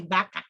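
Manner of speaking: "vaca" begun with a B; Latin American B-sound. Shown as a demonstration. Teacher shows Latin American usage, "vaca" said as baka. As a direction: lips closed to start "vaca", like baka. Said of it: "But in Latin America, they say".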